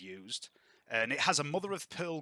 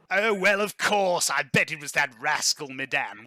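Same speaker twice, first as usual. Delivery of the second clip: loud, hearty gossiping aristocrat; boisterous lord, amused; 4 semitones higher, 6 dB louder than usual. Acted. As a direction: rib an old friend with booming relish. Game master voices jovial Laurent.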